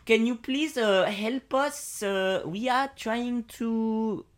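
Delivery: hoog en traag Frans; French accent. Acted. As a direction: Franse moeder smeekt om hulp. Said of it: "I'll be French".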